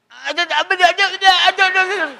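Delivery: flustered high ada. Retold or imitated. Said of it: "Dia jawab".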